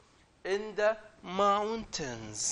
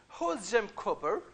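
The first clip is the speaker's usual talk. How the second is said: ordinary delivery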